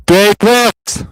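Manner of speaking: loud, high call of a name. Real, not acted. Calls out a viewer's name.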